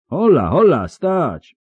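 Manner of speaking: high, loud "stop" call; calling a halt. Acted. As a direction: holler to halt them. Knight halting the others.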